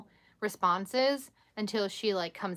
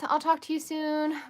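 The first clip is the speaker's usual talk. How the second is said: ordinary talk, nothing marked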